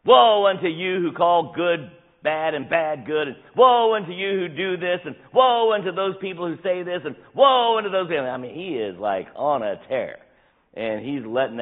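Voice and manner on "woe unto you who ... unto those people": angry prophet. Retold or imitated. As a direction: hurl each woe like a rebuke. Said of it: "He sounds angry".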